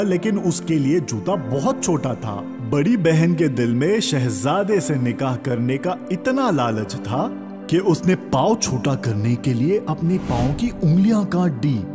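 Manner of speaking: plain reading voice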